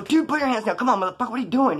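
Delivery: mildly heated, hurrying him along; exasperated. Retold or imitated. Exasperated at friend raising hands.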